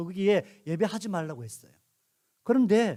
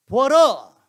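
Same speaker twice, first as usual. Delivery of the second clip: loud proclaiming shout, slightly higher; Aaron shouting; 4 semitones higher, 11 dB louder, much slower than usual. Acted. Aaron presents the golden calf to the people, loud proclaiming shout. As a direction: call out to the crowd to look. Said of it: "이제 아론이 소리칩니다".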